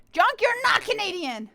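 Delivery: high, loud playful objection; loud protest. Real, not acted. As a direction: call them out loudly. Mock protest at a viewer's claim.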